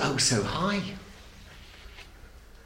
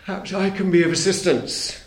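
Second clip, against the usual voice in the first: a sly, oily crocodile offering help, higher; oily wheezing crocodile; 5 semitones higher than usual. A narrator's reading. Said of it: "wheezed a particularly oily voice".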